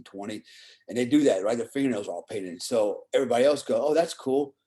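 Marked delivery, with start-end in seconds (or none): none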